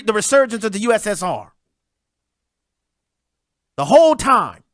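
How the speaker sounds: usual speaking voice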